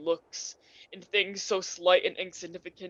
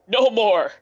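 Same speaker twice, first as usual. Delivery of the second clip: the repeat breaks into a louder, anguished cry; louder desperate repeat; 2 semitones higher, 7 dB louder than usual. Acted. Scrooge pleading with the spirit, crying out louder. Begging spirit to take him away.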